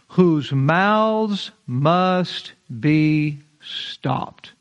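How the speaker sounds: "whose mouths must be stopped" stretched very slow; solemn, very slow quote; slow scripture emphasis. Acted. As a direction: drag every word out for weight. Quoting scripture with weight.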